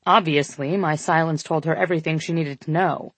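plain reading voice

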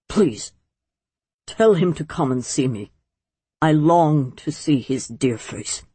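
low, understated plea; cracking imperious voice. A narrator's reading. Old uncle longing to see his nephew, faintly pleading. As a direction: let pride give way a little. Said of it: "a tiny crack in his imperious voice".

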